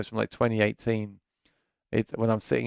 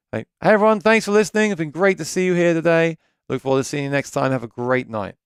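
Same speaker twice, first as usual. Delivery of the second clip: gig stage voice; 5 semitones higher than usual. Shown as a demonstration. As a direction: work the room from the stage mic. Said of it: "when you talk on the mic at the gigs".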